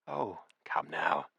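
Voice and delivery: the widow whispering to lure him to the records; coaxing whisper. A narrator's reading. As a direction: breathe it out slowly to tempt him. She smiles, coaxing him.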